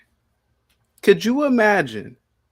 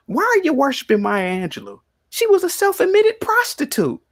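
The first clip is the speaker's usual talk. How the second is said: high-pitched indignant retelling, rising to a louder finish; scandalized denunciation, climbing in pitch; indignant challenge; 14 semitones higher, 3 dB louder than usual. Retold or imitated. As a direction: build the outrage toward the last word. Retelling his outraged challenge to students.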